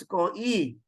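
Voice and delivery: ko-i as two syllables, ko then ee; wrong reading. Shown as a demonstration. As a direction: split ko-i, slowly, with a separate long i. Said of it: "So you do not read".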